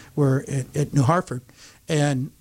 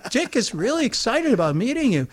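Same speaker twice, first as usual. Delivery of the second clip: enthusiastic retelling; 7 semitones higher than usual. Retold or imitated. Wife passing on husband's excitement.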